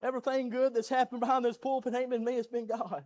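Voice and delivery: ordinary delivery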